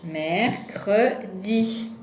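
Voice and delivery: "Mercredi" as mer, cre, di, slowly; slow teaching split of "Mercredi"; syllable by syllable. Shown as a demonstration. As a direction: separate the three syllables of "Mercredi". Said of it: "la première syllabe".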